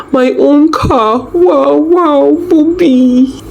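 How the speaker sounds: high, slow, ecstatic cries; woman overjoyed by a surprise car; joyful screaming. A narrator's reading. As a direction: squeal with delight. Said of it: "She kept screaming".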